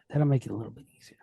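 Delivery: soft unvoiced whisper, fast; whispered aside. Real, not acted. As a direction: whisper it quickly to yourself. Aside to self while writing.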